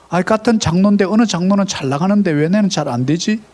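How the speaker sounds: usual speaking voice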